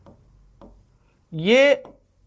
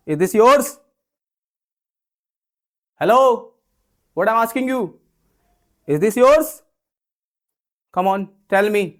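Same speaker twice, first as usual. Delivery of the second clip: higher, louder, pushy question; cocky demanding; 4 semitones higher, 4 dB louder, much slower than usual. Shown as a demonstration. Teacher pressing a student about a pen, cocky and loud. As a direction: demand an answer with swagger. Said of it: "थोड़ा अकड़ के पूछ रहे हैं".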